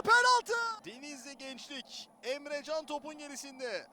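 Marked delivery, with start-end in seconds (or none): 0.0-0.8 loud penalty call; shouting penalty. Real, not acted.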